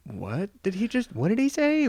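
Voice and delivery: baffled, rising in disbelief; what did he just say; confused disbelief. Retold or imitated. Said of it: "and you're like".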